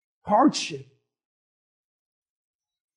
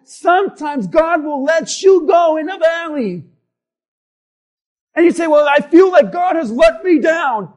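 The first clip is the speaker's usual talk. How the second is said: loud, raised sermon delivery; impassioned preaching; 6 semitones higher, 8 dB louder than usual. Acted. Preacher voices believers' doubts to the congregation, impassioned preaching. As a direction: preach it hard, punching key words.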